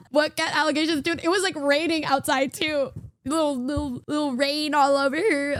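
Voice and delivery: ordinary talk, nothing marked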